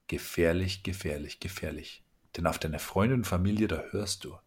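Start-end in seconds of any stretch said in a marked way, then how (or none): 0.1-2.0 three warnings, each one softer until almost gone; fading warning. Acted. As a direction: let it die away to nearly nothing. Warning repeated, fading each time. (leiser werdende Warnung)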